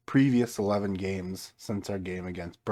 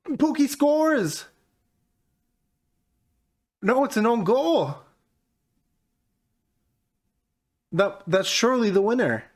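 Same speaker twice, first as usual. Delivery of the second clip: high, loud goal celebration; excited shout; 14 semitones higher, 6 dB louder than usual. Real, not acted. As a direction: yell it like a last-minute winner. Late goal in football game.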